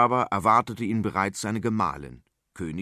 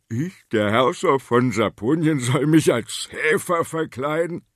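louder, outraged royal protest; indignant outrage; 3 semitones higher, 7 dB louder than usual. Acted. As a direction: bristle at the insult. King outraged at shepherd plan. (empörter König)

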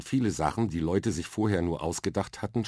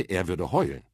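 ordinary delivery, nothing marked

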